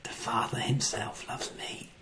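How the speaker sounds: "The Father himself loves me." fully whispered. Shown as a demonstration. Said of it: "And you can say".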